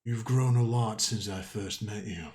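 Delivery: a hint of an ancient being; lightly voiced lightning creature. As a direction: speak as a calm, knowing creature.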